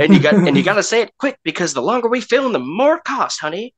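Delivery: high, loud, fast transatlantic patter; fast-talking old Hollywood voice. Pretend. Mocking old film actors to a friend, fast transatlantic patter. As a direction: rattle it off like a 1940s film star.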